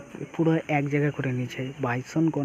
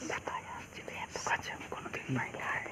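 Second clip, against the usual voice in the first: whispering; 6 semitones lower, 10 dB quieter than usual. Real, not acted. Hushed while watching wild animals.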